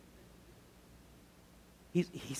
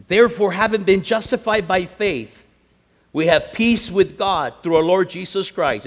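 loud, raised, emphatic Bible reading; scripture proclamation; 6 semitones higher, 11 dB louder than usual. Real, not acted. Preacher reading Romans five to the church, declaiming. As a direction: proclaim the verse to the congregation.

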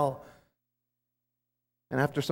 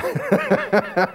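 high laugh; 11 semitones higher than usual. Real, not acted. Laughs at his own joke.

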